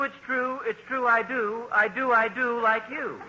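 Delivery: reads the valentine verse in a bouncy sing-song lilt, rising on the repeats; sing-song poem reading. Acted. Neighbor reads his homemade valentine poem aloud, bouncy sing-song. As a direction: recite it like a corny greeting-card rhyme. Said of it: "May I read it?".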